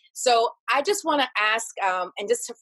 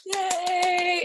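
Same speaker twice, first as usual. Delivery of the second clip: excited cheer; 9 semitones higher than usual. Real, not acted. Host cheers guest's story.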